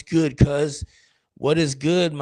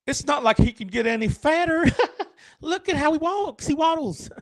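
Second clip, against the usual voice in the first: much higher, giggly, catty squirrel; high squeaky squirrel trash talk; gossipy squirrel voice; 12 semitones higher than usual. Retold or imitated. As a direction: squeal it like a mean little rodent mocking a waddler. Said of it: "he's like".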